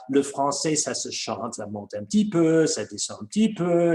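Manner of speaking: lilting Swiss French, pitch rising then falling; sing-song Swiss French. Shown as a demonstration. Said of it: "it's a very melodic kind of French".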